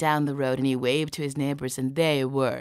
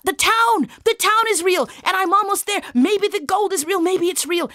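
very high, loud excited cry; elated, high-pitched exclaiming; thrilled discovery; 8 dB louder than usual. Acted. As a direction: shout it as a dream coming true. Isaac thrilled at seeing the town.

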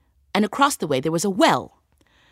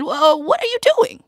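astonished; 9 semitones higher than usual. Acted. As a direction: stop him with a high Whoa. Tailor stops the man.

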